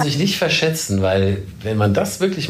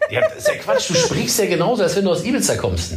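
disbelieving colleague; 8 semitones higher than usual. Retold or imitated. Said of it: "sag mal".

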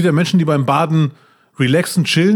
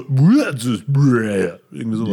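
reversed-tape sound; 4 semitones lower, much slower than usual. Shown as a demonstration. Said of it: "es wird komisch klingen".

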